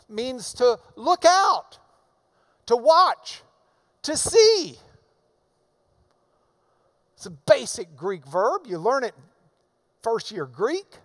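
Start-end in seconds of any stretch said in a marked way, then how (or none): 0.1-4.8 preaching emphasis. Acted.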